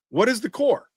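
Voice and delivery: the question shoots up high, in disbelief; exasperated demand. Retold or imitated. Repeated demand, pitch jumps.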